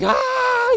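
high, loud "aah"; imitated child's scream. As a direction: scream like a child on a thrill ride. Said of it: "He's screaming".

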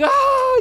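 high, loud "aah"; imitated child's scream. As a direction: scream like a child on a thrill ride. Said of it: "He's screaming".

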